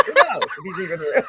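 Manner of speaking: high, cracking up mid-sentence; laughing. Real, not acted. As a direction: squeal it out through laughter. Joking about the monster, laughing.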